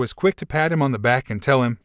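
plain reading voice

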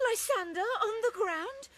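ordinary talk, nothing marked

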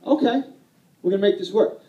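rally yourself, upbeat; wife deciding to handle the launch party alone, upbeat; determined okay. Retold or imitated. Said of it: "she was like".

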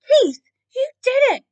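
high, loud, jubilant cry; cheering. A narrator's reading. The other animals congratulating Keith, cheering. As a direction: cheer him on in amazement. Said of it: "the other animals cheered".

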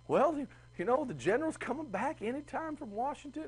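put-on high, soft old-lady voice; imitating Martha Washington. Teacher imitates the Martha Washington guide, high and soft. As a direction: play a sweet costumed Martha Washington. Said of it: "she's like".

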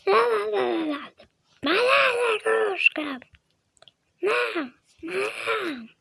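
high, slow wailing cry; crying over toy. Pretend. Child crying to keep her toy, high slow wail.

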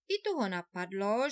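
plain reading voice

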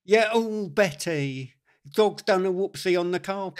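ordinary talk

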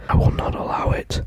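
whispered dramatic vow. Acted. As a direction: breathe it as a secret threat.

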